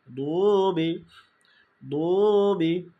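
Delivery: "দুবি" with a long drawn দু; এক আলিফ টান. Shown as a demonstration. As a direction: slowly stretch the first vowel of "দুবি". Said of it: "দুতে এক আলিফ টান আসবে". ("দুবি"-র দু এক আলিফ টেনে)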